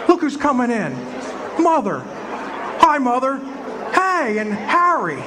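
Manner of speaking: ordinary talk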